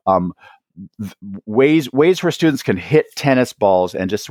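usual speaking voice

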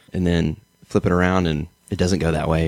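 ordinary delivery

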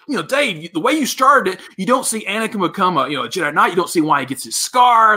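high, animated mock complaint; retold yelling. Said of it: "that's what I yelled at him".

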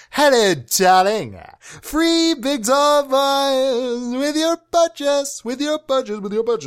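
high, slow put-on voice; silly character voice. Shown as a demonstration. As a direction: croon it like a cartoon. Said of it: "Let me try some other ones".

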